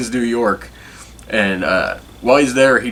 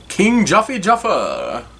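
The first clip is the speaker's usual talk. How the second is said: slow, much higher, badly faked African accent; bad Zamundan accent; 10 semitones higher, slower than usual. Imitating the king of Zamunda for viewers, hammed-up accent. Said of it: "That was a horrible accent".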